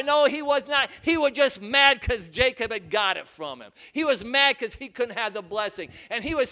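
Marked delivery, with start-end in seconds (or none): none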